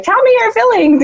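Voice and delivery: very high-pitched mom pleading for feelings; eager mom. Retold or imitated. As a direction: coax it out in a high sing-out. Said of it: "I'm a mom and I'm a woman".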